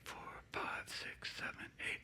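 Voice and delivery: counting softly under breath; whispered counting. Real, not acted. Counting the beat for the assistant.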